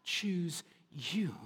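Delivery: slow tender whisper. Retold or imitated. God choosing his people, slow tender whisper. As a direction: breathe it slowly, intimately. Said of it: "he said".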